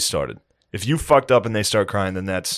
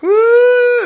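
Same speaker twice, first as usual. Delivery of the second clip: very high, loud playful whoop; silly high woo; 9 dB louder than usual. Shown as a demonstration. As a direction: whoop to distract a crying baby. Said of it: "I'm like".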